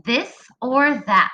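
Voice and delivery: slow, clear read-aloud of a question; teacher reading a sentence aloud; slow modeled reading. Shown as a demonstration. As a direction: read it slowly for the kids to follow. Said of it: "It says".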